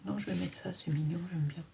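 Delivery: ordinary talk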